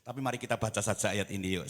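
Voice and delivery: ordinary talk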